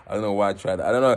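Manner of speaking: plain reading voice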